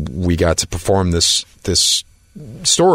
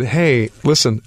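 slow, higher-pitched sheepish opener; 6 semitones higher, slower than usual. Retold or imitated. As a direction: imitate yourself easing into an awkward request. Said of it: "I sheepishly called her up and said".

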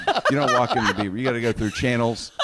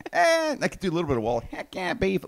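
ordinary talk

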